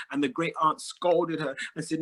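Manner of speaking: usual speaking voice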